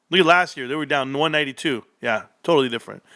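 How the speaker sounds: usual speaking voice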